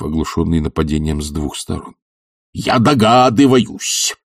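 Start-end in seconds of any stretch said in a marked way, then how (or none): 2.5-4.1 slow, high angry exclamation; spiteful exclamation. Acted. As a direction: draw it out with malice. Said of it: "злобно краснея воскликнул".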